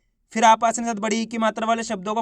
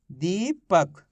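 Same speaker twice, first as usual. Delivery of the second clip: दीपक as rising दी, short gap, lower पक; syllable split; 4 semitones lower, much slower than usual. Shown as a demonstration. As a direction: read back Deepak in two parts. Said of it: "तो मिलके बन गया अपने". (दीपक को दी और पक में तोड़कर)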